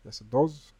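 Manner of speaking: loud, emphatic correction; shouted. A narrator's reading. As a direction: raise the voice to contradict yourself. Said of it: "shouted Zaphod".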